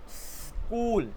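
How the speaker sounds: स्कूल with a long hissed s, then कूल; breath out first. Shown as a demonstration. Teacher shows the breath trick, long-hissed स्कूल. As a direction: slowly hiss the s of स्कूल before saying कूल. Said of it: "सांस को जब बाहर निकालोगे".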